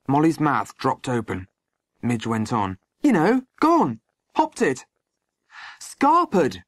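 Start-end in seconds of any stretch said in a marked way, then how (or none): 3.0-6.6 put-on high-pitched, bold young voice; cheeky boy. A narrator's reading. Boy explaining to stunned Molly, cheeky and emboldened.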